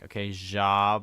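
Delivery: jab drawn out, slow and careful; said slowly. Retold or imitated. Retelling how he said the word slowly to a coworker, drawn out. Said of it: "Say it slowly".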